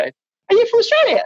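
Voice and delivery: very high, hammed-up clueless customer; mock customer. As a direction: squeak it like a dopey punter. Customer asking again.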